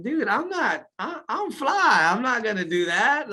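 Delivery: high-pitched comic protest; mock-indignant, voice jumping way up; indignant refusal. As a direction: refuse in a squeaky, offended pitch. Retold indignant refusal to doctor.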